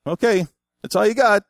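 snatch the book away with a taunt; mocking book taker. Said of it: "and go".

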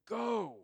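"Go." punched high and loud; Jesus commanding the women to go; emphatic command. Retold or imitated. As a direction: send them off with one sharp word. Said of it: "it's emphatic".

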